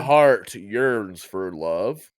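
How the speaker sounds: very slow, flat, emotionless; slow monotone. Pretend. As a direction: drag it out deadpan. Ghost declaring love, monotone.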